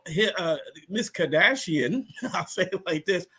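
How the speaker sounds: ordinary delivery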